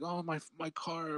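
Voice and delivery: high, soft, slow lament; retold distress. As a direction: imitate her mourning her car. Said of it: "she was so sad about her car".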